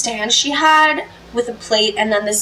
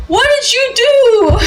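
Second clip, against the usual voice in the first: put-on slow, high, loud old Greek man accent; 12 semitones higher, 5 dB louder than usual. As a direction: shout it slowly like an old Greek grandpa. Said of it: "His old, like, Greek accent".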